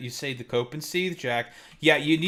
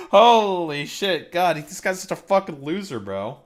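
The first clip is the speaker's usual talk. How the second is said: laughing at a chat commenter; 5 dB louder than usual. Real, not acted. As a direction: laugh the words out.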